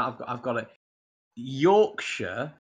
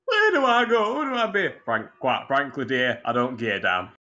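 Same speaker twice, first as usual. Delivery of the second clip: put-on Yorkshire accent, pitched up for the opening; mock-dramatic Yorkshire quote. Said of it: "The Yorkshire version of Gone with the".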